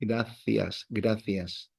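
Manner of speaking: "Gracias" said softly with the c as th; Castilian th. Shown as a demonstration. Teacher showing it is no lisp, Castilian th. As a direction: say "Gracias" as in Spain, a th in place of s. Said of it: "they don't have a lisp".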